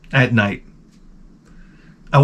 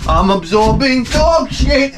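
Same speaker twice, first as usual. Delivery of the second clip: much higher, slow, hammed-up dismay on "dog"; shrill mock horror; 12 semitones higher, slower than usual. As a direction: whine "dog shit" up high in fake panic. Repeat, higher.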